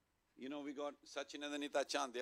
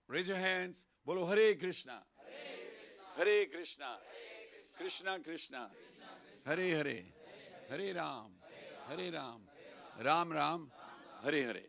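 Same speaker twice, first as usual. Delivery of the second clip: loud mantra call, pausing for the response; leading call-and-response; 4 semitones higher, 4 dB louder than usual. Shown as a demonstration. Speaker getting everyone to repeat the mantra, calling out. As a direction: call out each short phrase for the crowd.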